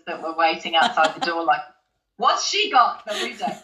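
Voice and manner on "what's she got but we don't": higher, sniffy dancer's mutter; mock the jealous dancers, higher; catty jealousy. Jealous dancers in the queue, catty and higher.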